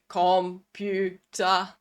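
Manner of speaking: slow syllable-chopped robot "Com-pu-ter". Pretend. Parent playing a robot game with child, robot voice. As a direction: break "Computer" into beats like a toy robot. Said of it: "let's talk like a robot".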